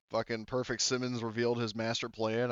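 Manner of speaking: usual speaking voice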